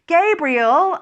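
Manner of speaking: loud, high shout of a name; yelled name. A narrator's reading. Oriel shouting after the departing Gabriel, loud high yell. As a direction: yell after him to stop him. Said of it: "I yelled".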